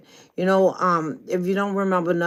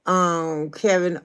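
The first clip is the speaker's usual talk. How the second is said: ordinary talk, nothing marked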